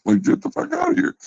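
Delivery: high-pitched, rapid mock disbelief; mocking scoff. Mocking scoff at trash talkers.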